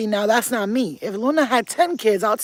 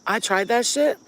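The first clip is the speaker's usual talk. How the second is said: ordinary talk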